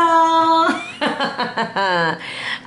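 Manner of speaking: high laugh tailing downward. Real, not acted. Reader amused by the weasel card, laughing high. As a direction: break into a high, drawn-out laugh.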